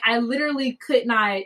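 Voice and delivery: ordinary delivery, nothing marked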